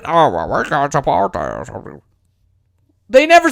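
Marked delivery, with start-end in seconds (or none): 0.0-2.0 lower, softer put-on voice of feigned regret; mock Republicans. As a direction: fake apologetic refusal, voice dropped low. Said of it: "and they're".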